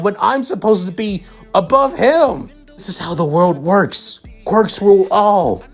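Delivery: anguished, high-pitched outburst; Bakugo. A narrator's reading. Bakugo learns Izuku won and beat him, anguished outburst. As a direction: build from stunned disbelief to a high, wailing rage. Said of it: "as tears continue to slip".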